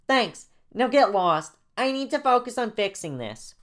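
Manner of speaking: put-on high, loud childish dismissal; bossy young kid. Jaden dismissing Joey to keep building, bossy childish voice. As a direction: send them off in a high kid voice. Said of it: "Jane talks like a seven-year-old".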